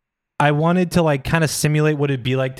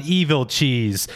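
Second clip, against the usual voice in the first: slow dramatic; much slower than usual. Acted. Reading AI script.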